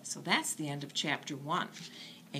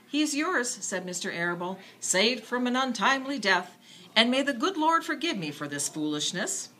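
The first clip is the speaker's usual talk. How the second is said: tender father; 6 semitones higher, 4 dB louder than usual. A narrator's reading. Father giving pig to daughter.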